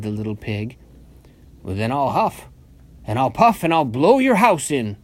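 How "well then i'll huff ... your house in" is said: building wolf threat, loud by the end; mild roar. A narrator's reading. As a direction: work up to a storybook roar. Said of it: "roared the wolf".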